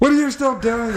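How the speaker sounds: high, pushy mock girlfriend voice; mock nagging girlfriend. Retelling how his girlfriend nagged him, in a high mocking voice.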